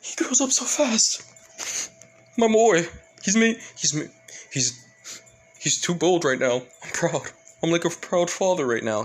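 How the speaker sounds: hammed-up weepy proud dad; dramatic tearful pride. A narrator's reading. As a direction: gush like a teary proud father. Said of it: "the little dramatic, sa-, just tearing up".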